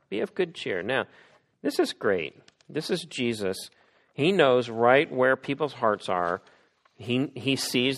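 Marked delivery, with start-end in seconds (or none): none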